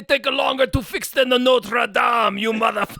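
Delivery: loud, high hammed-up alien junk dealer; French Watto. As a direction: bark it like a greedy alien with a French twist. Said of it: "transitioning to Watto".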